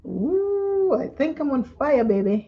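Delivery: high thrilled coo; excited. Real, not acted. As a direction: gush over the color. Thrilled at color swatch.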